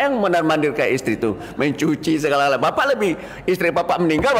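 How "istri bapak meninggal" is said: ordinary talk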